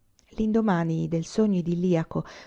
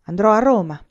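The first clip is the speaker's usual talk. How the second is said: louder, eager declaration; impetuous; 3 semitones higher, 7 dB louder than usual. A narrator's reading. Boy announcing his plans to his friend, with impetus. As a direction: push it out with impetus. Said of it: "rispose Anania con impeto".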